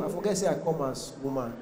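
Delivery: ordinary talk, nothing marked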